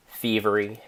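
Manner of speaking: ordinary delivery, nothing marked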